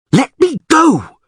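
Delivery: high, slow, desperate shout; furious scream. A narrator's reading. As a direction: scream at him while kicking. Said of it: "she screamed".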